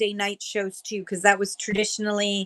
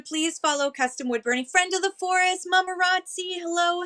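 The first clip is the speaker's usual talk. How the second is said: normal talking voice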